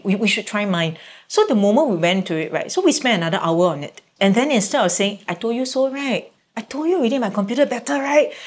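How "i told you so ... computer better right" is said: teasing gloat. Retold or imitated. The gloating line she held back.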